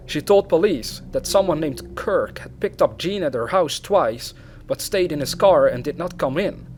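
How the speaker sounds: ordinary delivery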